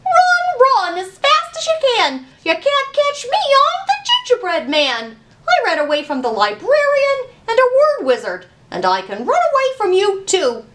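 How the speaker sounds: high, loud sing-song taunt; taunting gingerbread man. Acted. Gingerbread man taunts the librarian, high and loud. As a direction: tease them as you run off.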